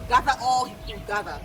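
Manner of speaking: usual speaking voice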